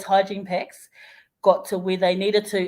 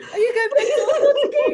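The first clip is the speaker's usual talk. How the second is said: high, fast, laughing question; laughing repeat; 4 dB louder, much faster than usual. Retold or imitated. Repeating the boys' question to the driver, fast and laughing. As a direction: rattle it off through laughter. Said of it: "they're like".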